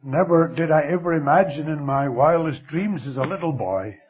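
ordinary delivery

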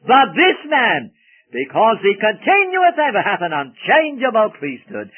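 preaching at full force; loud declaiming. Real, not acted. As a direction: ring it out from the pulpit. Preacher thunders scripture reading.

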